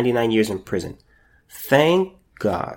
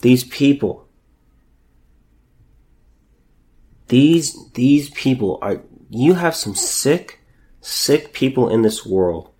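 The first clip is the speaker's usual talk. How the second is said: angry condemnation; slower than usual. Real, not acted. Condemning the parents on camera.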